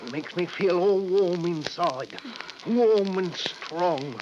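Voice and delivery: very slow, dreamy drawl; Henry spellbound telling his wife; entranced. Acted. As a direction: savour each word under the spell. Spellbound by the knife again.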